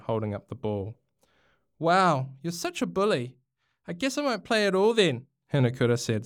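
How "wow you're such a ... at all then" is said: higher, huffy retort; indignant. A narrator's reading. Hinakura quits the game and storms off, indignant. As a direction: fling it back in a huff. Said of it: "storming off the field".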